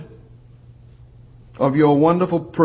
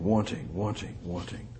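"wanting" repeated, each one softer and lower; fading repetition; 7 semitones lower, 12 dB quieter than usual. Real, not acted. Preacher letting the word fade out, softer each time. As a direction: let "wanting" die away to nothing.

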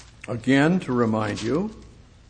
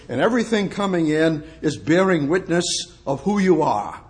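normal talking voice

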